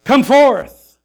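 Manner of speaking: high, loud call to come out; Jesus calling Lazarus. Retold or imitated. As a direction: call him out of the tomb. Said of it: "he calls us by name".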